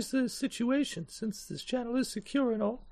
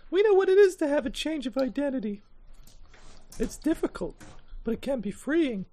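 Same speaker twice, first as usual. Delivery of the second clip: lightly raised character voice; higher NPC voice; 5 semitones higher, 3 dB louder than usual. Acted. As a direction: speak as the Double Helix contact, a bit higher. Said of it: "Double Helix character speaking".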